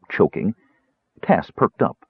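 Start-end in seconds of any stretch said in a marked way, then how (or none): none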